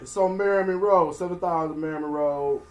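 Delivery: loud promo read; radio announcer voice. Pretend. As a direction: announce it like a club ad. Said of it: "since you got the radio voice".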